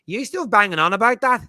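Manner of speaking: put-on high, loud Northern Irish child voice; Northern Irish accent. Son teasing his dad, in a high Northern Irish kid accent. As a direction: tease like a seven-year-old Northern Irish lad. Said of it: "In his thick Northern Irish accent".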